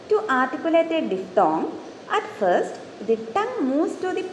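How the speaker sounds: very slow, deliberate textbook reading; dictating a definition slowly; slow dictation read. Shown as a demonstration. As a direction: read each word out for note-taking. Reads English definition slowly.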